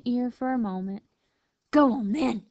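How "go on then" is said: loud, curt coyote bark. A narrator's reading. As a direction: bark a grudging dismissal. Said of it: "she barked finally".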